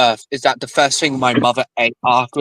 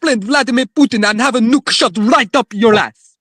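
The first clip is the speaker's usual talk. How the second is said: higher, hammed-up Russian menace; mock Russian accent; 6 semitones higher than usual. Joking threat to the family in a Russian voice. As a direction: threaten like a Putin fan. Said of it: "Mother Russia".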